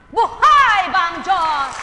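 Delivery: loud, slow call; drawn-out shout. Real, not acted. Preacher calls to the congregation, slow and loud. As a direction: stretch each word out loud.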